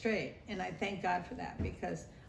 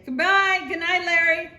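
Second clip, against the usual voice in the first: Dad calling out; 10 semitones higher, 12 dB louder than usual. Retold or imitated. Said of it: "Dad would say".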